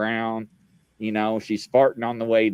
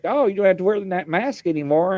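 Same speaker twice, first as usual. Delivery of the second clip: high, light mock doctor voice; a high, breezy doctor's reassurance; doctor telling him the mask can go, voiced high; imitating the doctor; 8 semitones higher than usual. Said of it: "she's like".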